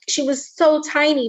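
ordinary delivery

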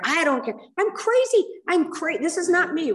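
shouting back at the friend who hushed her; heated outburst. Retold or imitated. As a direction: blurt it out, past caring. Her heated reply in meeting.

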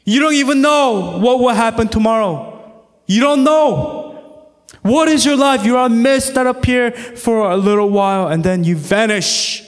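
full-force pulpit preaching. Acted. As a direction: drive the warning home to the congregation.

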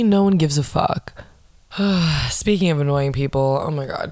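usual speaking voice

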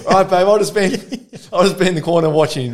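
high, loud, quick laughing retreat; husband tells wife he will wait in the corner, laughing and fast; 1 sheepish laughing. Retold or imitated. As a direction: rattle it off laughing as the banished husband.

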